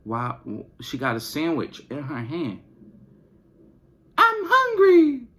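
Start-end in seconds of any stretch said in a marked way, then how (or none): 4.2-5.3 put-on very high, slow whine of a hungry kid; whiny child voice. Mom imitating her daughter, very high, slow, whiny. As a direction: stretch it out like a pleading little girl. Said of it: "Isabella says".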